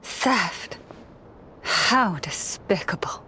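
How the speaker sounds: hushed and scornful; disgusted whisper. Acted. Leader hears of a theft.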